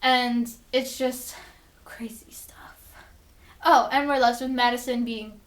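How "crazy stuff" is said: breathy whisper of awe; whispered aside. Real, not acted. Vlogger marvelling at the episode ending, whispered. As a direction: whisper it like a secret.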